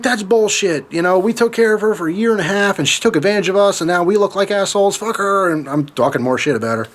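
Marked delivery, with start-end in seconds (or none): 0.0-5.5 higher-pitched, aggrieved venting; worked-up complaint, replaying the anger; retold indignant rant. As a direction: protest how unfair it is, voice pitched up. Retold angry rant to dad.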